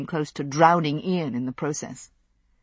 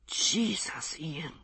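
a stricken, unvoiced whisper; 11 dB quieter, much slower than usual. A narrator's reading. Uncle whispers to his tattooed nephew in shock. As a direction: breathe the name out in shock. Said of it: "he whispered".

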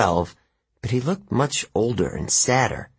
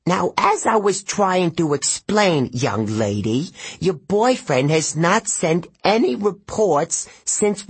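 high, condescending satyr, slower; pompous old satyr; 8 semitones higher than usual. A narrator's reading. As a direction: lecture the young lady haughtily. Satyr lectures Juniper.

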